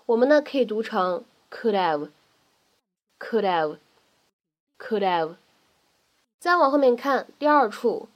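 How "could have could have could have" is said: "could have" run together as "could-av", dropping the h; linked pronunciation. Shown as a demonstration. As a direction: join "could have" into one word without an h. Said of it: "可以做一个非常典型且常见的击穿".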